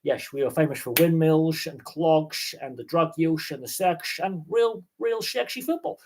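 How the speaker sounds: put-on high, playful Dutch accent. Podcaster repeating his Dutch bit, high playful Dutch accent. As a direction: keep the Dutch voice going. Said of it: "I do like doing my Dutch accent".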